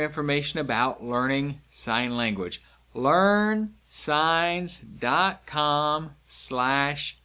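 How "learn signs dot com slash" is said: very slow, separated words; slow, word-by-word address. Shown as a demonstration. Teacher giving learners the lesson website, slow word by word. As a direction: stretch out each word with gaps between. Spells out website while signing it.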